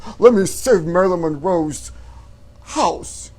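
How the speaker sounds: hammed-up Travolta impression; John Travolta voice. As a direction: play John Travolta pleading to save the house. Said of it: "You like my John Travolta voice?".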